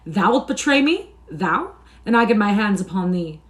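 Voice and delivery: loud, high, incredulous outrage; acted fury, pitch leaping on the question; outraged threat. A narrator's reading. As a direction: flare up in disbelief, then threaten. Enraged man threatens the boy.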